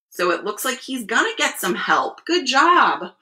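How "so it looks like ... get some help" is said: ordinary delivery, nothing marked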